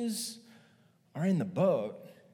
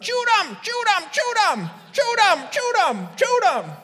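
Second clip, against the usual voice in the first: much higher, louder Cajun yells over and over; frantic screaming; 10 dB louder than usual. Retold or imitated. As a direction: scream it at your son in a panic. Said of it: "Troy Landry is screaming at his son".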